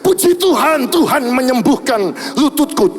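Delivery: preaching a miracle testimony. Acted. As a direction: proclaim the healing to the congregation. Membacakan kesaksian mukjizat dengan semangat berkhotbah. (berkhotbah berapi-api)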